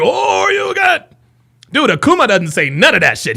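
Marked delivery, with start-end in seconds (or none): 0.0-1.1 high, slow shouted fighting-game call; imitating a game character's battle cry; Street Fighter shout. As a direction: yell it like a special move. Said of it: "they're like".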